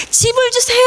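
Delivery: high, acted pleading cry; begging Bartimaeus. Guessing Bartimaeus's wish for a house, high begging. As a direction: call it out high as a mock plea. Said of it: "바디메오가 제일 원하는 게".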